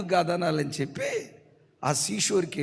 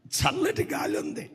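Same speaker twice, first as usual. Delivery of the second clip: higher, louder preacher's build; fervent preaching; 7 semitones higher, 4 dB louder than usual. Real, not acted. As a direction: paint the sea scene with rising fervour. Preacher builds scene with fervour.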